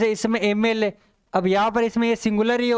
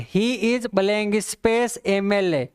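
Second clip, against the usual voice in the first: slow dictation; much slower than usual. Shown as a demonstration. Reading out the exam question.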